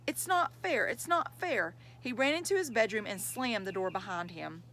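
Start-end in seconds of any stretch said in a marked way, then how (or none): 0.1-1.7 high whine of protest, falling on repeat; tantrum protest. A narrator's reading. Child's tantrum at his mother.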